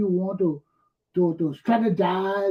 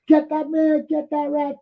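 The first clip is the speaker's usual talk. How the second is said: much higher, louder mob shout; crowd yelling; 8 semitones higher, 4 dB louder than usual. Retold or imitated. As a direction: yell like a mob going after him. Crowd chasing the Solicitor General.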